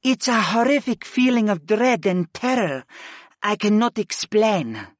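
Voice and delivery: high character voice with dread; dread-filled goddess. Acted. Utuni confirms a horrific feeling to Griff, dread-filled.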